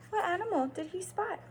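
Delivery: ordinary talk, nothing marked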